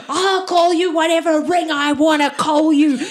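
high, loud mock-angry outburst; defiant retort. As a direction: hurl it defiantly mid-scuffle. Defiant shouting during a scuffle.

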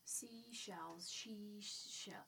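breathy whisper, very soft. Shown as a demonstration. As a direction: whisper the tongue twister. Whispering a tongue twister.

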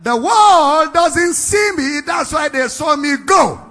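high, shouted proclamation with drawn-out words; loud, slow, impassioned preacher's declamation; full-force preaching. Acted. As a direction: thunder it out as a divine promise.

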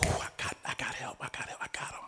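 hushed thankful gasp of relief; relieved whisper. Retold or imitated. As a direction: whisper it like help just arrived.

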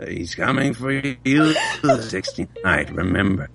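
a hint of a creepy old prophet; devil voice. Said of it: "Do the devil thing".

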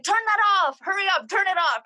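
high-pitched imitation of a nagging mom; mom's urgent order. Daughter imitating mom ordering the TV off, urgent. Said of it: "my mom would be like".

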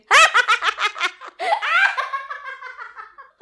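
long, high laughing fit; long helpless laugh. Real, not acted. Laughing at her own story, long helpless laugh. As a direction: laugh until you can't stop.

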